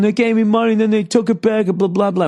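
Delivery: high-pitched mock complaint; high, worked-up mock rant; retold yelling. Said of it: "as he's yelling".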